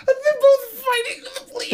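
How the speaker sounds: very high, wheezing with laughter; real tearful laughter; crying laughing. Real, not acted. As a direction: squeak it out while cracking up. Cracking up at bleach fight.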